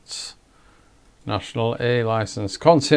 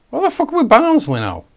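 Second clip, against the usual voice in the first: high, loud, fast outburst; startled, rapid high-pitched exclamation; indignant surprise; 13 semitones higher, 5 dB louder, faster than usual. Real, not acted. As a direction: blurt it out loudly in high-pitched disbelief. Startled by wrong team on screen.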